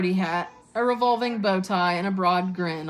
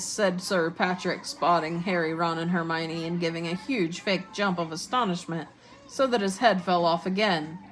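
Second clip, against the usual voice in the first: ordinary delivery, nothing marked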